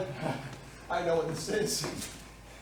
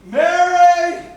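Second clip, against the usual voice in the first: loud, high call through the house; yelling out; 5 semitones higher, 10 dB louder than usual. Acted. Husband searches the empty house for his wife, yelling. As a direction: shout for someone missing. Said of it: "What's he yelling for?".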